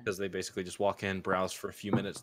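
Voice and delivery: ordinary talk, nothing marked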